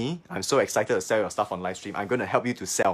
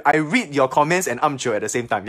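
ordinary talk

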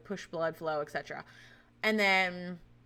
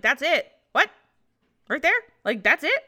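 loud, higher, rising disbelief; incredulous questioning; 6 semitones higher, 8 dB louder than usual. Retold or imitated. Patient shown the tiny embryo on a picture, incredulous. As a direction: echo it as baffled questions, ending in laughter. Incredulous echo of nurse's words.

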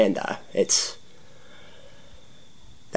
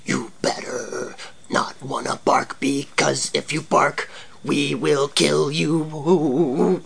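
spooky intro voice; 4 semitones higher, slower than usual. Acted. Spooky intro to dark podcast.

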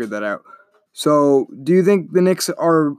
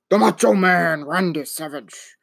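drawn-out wrestler impression, starting high and falling away; Macho Man Randy Savage; 3 semitones higher than usual. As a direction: do an embarrassing, hammed-up wrestler catchphrase voice. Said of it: "Macho Man impression than that".

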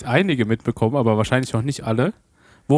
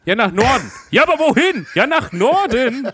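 high, hammed-up sketch dialogue; comedy sketch voice; 9 semitones higher than usual. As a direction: play it like a silly Otto sketch. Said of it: "wie so ein schlechter Otto-Sketch".

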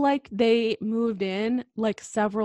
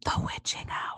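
whispered, ominous hush; spooky whisper; 8 dB quieter, faster than usual. Acted. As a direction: whisper it like a ghost story.

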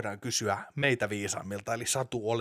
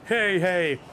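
departing superhero call; 7 semitones higher, 6 dB louder than usual. Acted. Superhero calls goodbye, swinging away.

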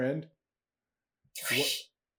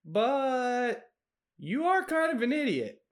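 sheepish goblin; 6 semitones higher than usual. Acted.

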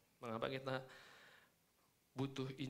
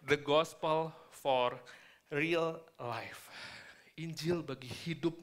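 slow, louder title through braces; 3 dB louder, much slower than usual. Real, not acted. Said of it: "pakai behel agak susah".

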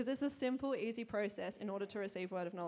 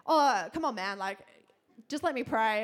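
pleading; 7 dB louder than usual. Retold or imitated. Pleading with stranger to pray.

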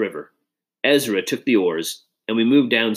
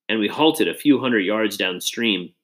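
ordinary delivery

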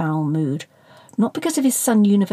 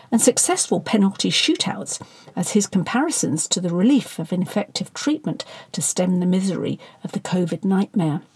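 ordinary delivery, nothing marked

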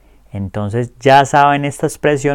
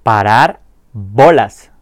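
"Parar bolas" said slowly and clearly, stress on "bolas"; slow model pronunciation; 4 dB louder, slower than usual. Shown as a demonstration. Teacher models "Parar bolas" slowly, clear and deliberate. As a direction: spell out "Parar bolas" slowly for learners to copy. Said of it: "Empecemos diciendo la expresión como tal".

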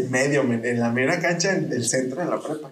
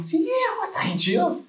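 mock self. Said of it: "Y yo".

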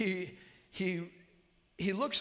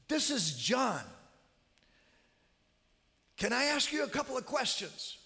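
high, raised voice, introducing himself; loud calling; 9 semitones higher, 3 dB louder than usual. Retold or imitated. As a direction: call out clearly to a frail listener. Calling to deaf mother-in-law.

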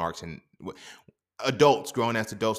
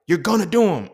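teacher insisting; 8 semitones higher, 9 dB louder than usual. Retold or imitated.

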